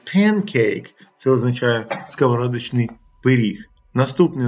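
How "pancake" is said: high «pancake» with open ae; «pancake» with a wide ae in pan; English pancake. Shown as a demonstration. Teacher models pancake for the class. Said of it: "Навіть коли кажуть".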